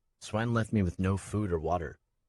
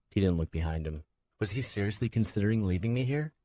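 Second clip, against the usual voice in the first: ordinary delivery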